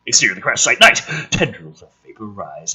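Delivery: ordinary delivery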